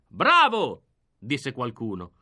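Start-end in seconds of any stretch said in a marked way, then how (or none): 0.1-0.8 loud, high shout of bravo; cheer. Acted. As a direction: call it out from the crowd. Audience member cheering.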